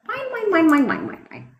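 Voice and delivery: high, whining sing-song mine, mine, mine; mocking whiny child. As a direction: mimic a bratty toddler grabbing toys. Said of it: "Like a little fucking child".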